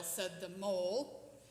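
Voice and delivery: ordinary delivery, nothing marked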